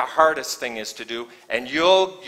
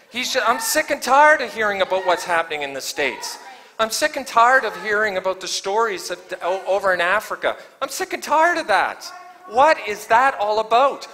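rising preacher's fervor, louder and higher; preaching at full force; 6 semitones higher, 3 dB louder than usual. Acted. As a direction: build the sermon to a passionate peak.

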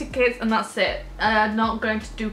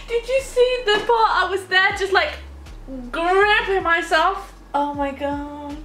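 squealing with laughter, can't believe it; laughing excitedly; 7 semitones higher than usual. Real, not acted. Giddy after seeing herself on stage.

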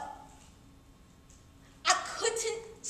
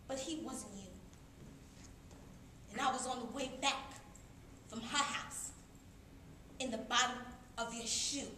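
soft, sinking lower, broken by long pauses; subdued confession; 5 semitones lower, 12 dB quieter than usual. Acted. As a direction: confess it quietly, letting the voice sink. Confessing hurt after the outburst.

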